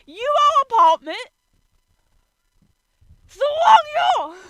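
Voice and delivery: loud, high, furious shouting; exasperated screaming at the text; angry outburst. Real, not acted. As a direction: yell at the page in outrage. Raging at fanfic's wrong year.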